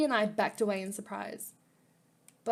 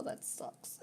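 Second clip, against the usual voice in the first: whispered aside; 15 dB quieter than usual. Real, not acted. Aside while reading game.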